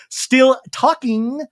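high, loud, drawn-out insistence; hammed-up refusal to be interrupted, stretched out; Anna Marie. Anna Marie holds the floor against Garcelle, high loud mock insistence. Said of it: "And Anna Marie goes".

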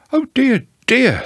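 high-pitched fretting little voice; concerned bear. A narrator's reading. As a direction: fret in a high character voice. Pooh sympathises in high voice.

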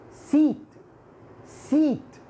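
سيت as "seat", held ee; long ya vowel. Shown as a demonstration. Teacher reminds students of ya, long ee in سيت. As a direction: lengthen the "ee" in سيت. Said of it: "ياء، تتذكرها".

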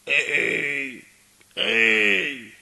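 loud, low 'hey' at a stranger; rough calling out. Acted. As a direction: holler to get his attention. Stranger in wheelchair calls out.

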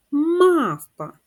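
"Мавпа" loud and high, first syllable punched; stress on first syllable. Shown as a demonstration. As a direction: hit "Мав" hard, let "па" fall. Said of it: "Наголос на перший склад".

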